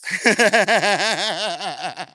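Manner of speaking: drawn-out, rattling cackle; long cackling laugh. Real, not acted. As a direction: let a long rolling laugh out. Laughs at own crickets joke.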